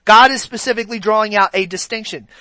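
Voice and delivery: ordinary delivery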